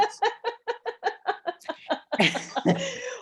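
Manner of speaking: high-pitched sustained laughter; long laugh. Real, not acted. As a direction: crack up at the punchline. Laughing at the story's punchline.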